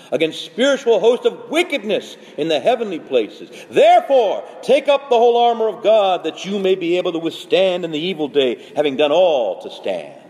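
high, loud, ringing battle summons; forceful scripture proclamation. Acted. Paul urging believers to take up armor, proclaimed forcefully.

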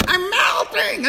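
high wailing witch cry; mock witch wail, high and strained; Wicked Witch melting. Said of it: "like the Wicked Witch".

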